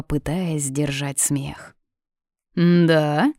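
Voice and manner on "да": questioning lift of mild surprise; father taken aback, asking; surprised. A narrator's reading. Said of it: "удивился отец".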